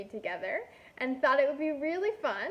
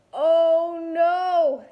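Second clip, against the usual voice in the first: a loud, higher cry of alarm; 4 semitones higher, 11 dB louder than usual. Acted. Marcel sees the kid climb toward his dinner, loud alarmed cry. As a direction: gasp it out as the monster panics. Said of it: "thought Marcel".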